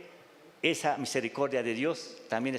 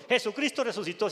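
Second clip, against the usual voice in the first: "Jesucristo resucitó" proclaimed higher and louder, with confidence; firm, loud declaration; 9 semitones higher, 3 dB louder than usual. Shown as a demonstration. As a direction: say it hard and sure, as the congregation is told to. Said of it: "Así, eso, duro".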